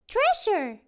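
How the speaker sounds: high, loud surprised question; surprised first mate. Acted. Callie hears of treasure, high surprised question. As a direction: gasp the word out in surprise. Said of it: "says First Mate Callie".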